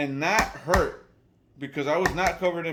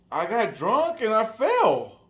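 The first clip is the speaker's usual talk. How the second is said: put-on slow, higher drunk confession; drunk guy; 4 semitones higher, 4 dB louder, slower than usual. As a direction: imitate a drunk owning up, drawn out. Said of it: "drunk as shit".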